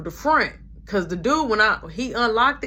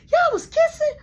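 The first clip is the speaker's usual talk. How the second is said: put-on very high, loud squeaky kid voice; high-pitched childish gasp of disbelief; kid brother's shocked squeal; 6 dB louder than usual. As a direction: squeal it like a shocked little boy. Repeated little brother quote.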